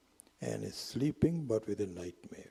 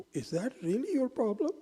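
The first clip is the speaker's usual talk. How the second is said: much higher and louder, teasing disbelief; high amused question; 14 semitones higher, 6 dB louder than usual. Acted. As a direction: pretend you can't believe that's the problem. Wise man teases the boys.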